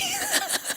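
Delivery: high breathy laugh through tears; a choked happy giggle; teary giggle. Real, not acted. Emotional giggle while recounting speech.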